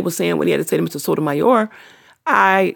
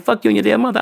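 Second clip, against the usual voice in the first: imitating trolls; 5 semitones higher, 3 dB louder, faster than usual. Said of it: "They'd be like".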